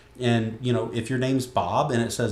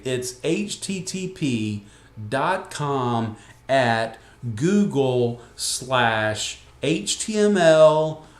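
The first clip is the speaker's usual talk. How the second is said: slow, halting dictation of a web address; drawn-out parody of a muddled relative dictating; imitated clueless relative; 5 dB louder, much slower than usual. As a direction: spell it out piece by piece, mock-earnest.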